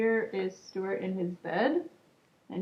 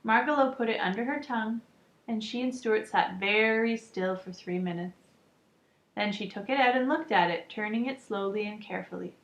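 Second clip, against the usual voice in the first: ordinary delivery, nothing marked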